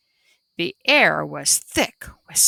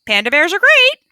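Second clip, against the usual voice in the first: high, louder squawky parrot echo; high squawking parrot repeat; parrot snicker; 9 semitones higher, 6 dB louder, faster than usual. A narrator's reading. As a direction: parrot snickering a copy of the panda. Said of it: "snickered right out loud".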